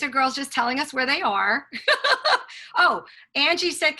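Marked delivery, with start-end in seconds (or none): 1.7-2.7 high, bright burst of laughter; real burst of laughter. Real, not acted. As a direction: laugh out loud at the chat. Laughing at chat messages.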